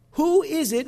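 ordinary delivery

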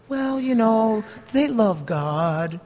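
lightly voiced excuse-maker, drawn out; mock excuse. Pastor mimicking a churchgoer's excuse for dating, lightly voiced. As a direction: brush it off like a naive believer.

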